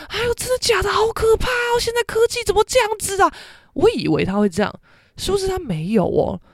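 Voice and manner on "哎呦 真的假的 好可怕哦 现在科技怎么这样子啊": much higher, gasping mock-alarm as a fussing mother; imagined shocked mom. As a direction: play the mother she expected, all shocked and fretting. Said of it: "她没有像我想那种".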